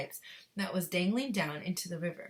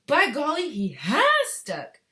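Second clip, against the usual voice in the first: surprised; 8 semitones higher, 11 dB louder, slower than usual. Acted. Charlie exclaims.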